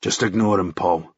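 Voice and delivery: low flat statement. Acted. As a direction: say it flatly, no interest. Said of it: "Tam stated, flatly".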